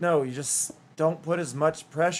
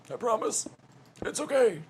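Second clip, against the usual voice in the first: much higher, small voice; high put-on reassurance; 7 semitones higher, 5 dB quieter than usual. Streamer reassuring everyone they are okay, high put-on voice. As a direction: squeak out a playful reassurance.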